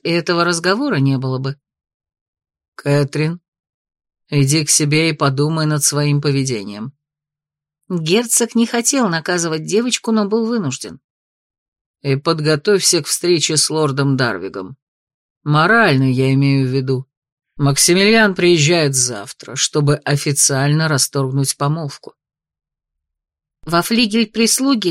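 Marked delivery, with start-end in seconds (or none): 2.8-6.9 stern father. Acted. Duke sends daughter away. 12.0-22.1 low, measured, stern lord; stern duke. Acted. Duke warns daughter.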